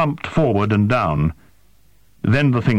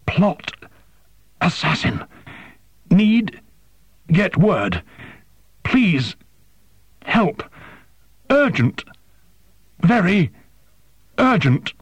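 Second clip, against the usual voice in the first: croaking; 10 semitones higher, slower than usual. Acted. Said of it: "swallowed with obvious pain and croaked".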